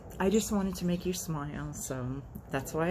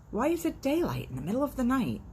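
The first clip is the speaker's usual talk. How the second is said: high, baffled cow voice; puzzled cow; 9 semitones higher than usual. Acted. Cow wondering why it is daylight, high and baffled. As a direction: wonder aloud, pitched up high.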